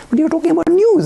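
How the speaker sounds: high, insistent comeback; pressing point. Retold or imitated. Jay pressing his point on the minister, high and insistent. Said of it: "Jay pressing the minister back".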